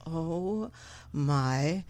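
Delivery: slow, low, drawn-out words with gaps; stunned realisation. Retold or imitated. Woman feeling the gummy hit, slow stunned realisation. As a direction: stretch each word as the drug hits. Acting out the gummy hitting her.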